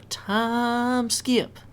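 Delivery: drawn-out, high-pitched announcer call; slow, playful sing-out; playful announcement. Pretend. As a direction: stretch it out high like a scene-change jingle. Announces a cut in the video.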